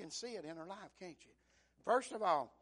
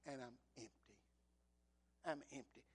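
lower, soft broken drop; sudden hush; 6 semitones lower, 7 dB quieter than usual. Acted. Preacher voicing Naomi admitting her emptiness, hushed. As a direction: let it fall to nothing. Naomi's lament after loud cry.

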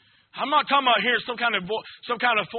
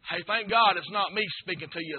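normal talking voice

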